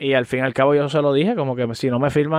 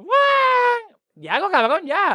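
retold yell; much slower than usual. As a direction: shout it up high, impatient. Retold father calling out.

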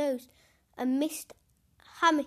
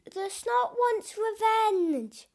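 spooky voice; 8 semitones higher, 5 dB louder, slower than usual. Acted. Spooky story line.

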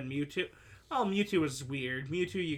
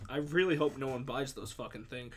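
usual speaking voice

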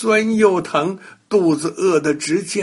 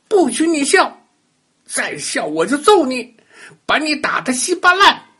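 high, loud furious threat; angry threat; 11 semitones higher, 3 dB louder than usual. Acted. Bully shouting threats at the laughing doll. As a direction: threaten him with rage.

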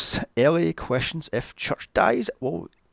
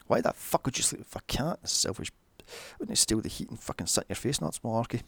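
muttered aside; 2 semitones lower, 7 dB quieter than usual. Real, not acted.

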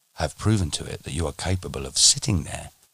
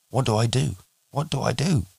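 rising, desperate plea, higher each time; pleading; 7 semitones higher than usual. Acted. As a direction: beg for help in rising panic. Student desperate, can't reach ten.

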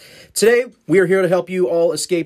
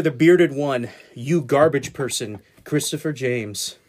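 usual speaking voice

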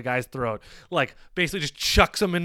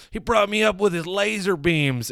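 hammed-up hillbilly witness; mock Southern accent; 6 semitones higher than usual. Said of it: "this really uneducated person being like".

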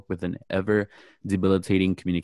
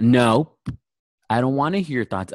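loud blunt "No", then higher and annoyed; retold angry refusal; 4 semitones higher, 4 dB louder than usual. Professor shutting down a student's thoughts, angry. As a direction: imitate a fed-up professor cutting someone off. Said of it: "she shuts the laptop".

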